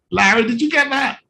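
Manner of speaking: ordinary talk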